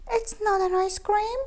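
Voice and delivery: high, quick squeal. A narrator's reading. Keith protesting to the cats in a quick squeal. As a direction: squeal it out fast. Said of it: "He squealed".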